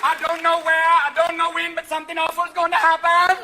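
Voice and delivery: high, loud psychic proclamation; loud, high mock Jamaican fortune-teller; Miss Cleo. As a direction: belt it out as a TV psychic's prophecy. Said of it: "are you working with Miss Cleo?".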